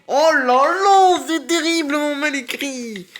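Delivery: very high, loud, drawn-out lament; exaggerated wail. Real, not acted. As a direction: wail in hammed-up horror at bad spelling. Appalled by misspellings while reading. (grande plainte aiguë et théâtrale)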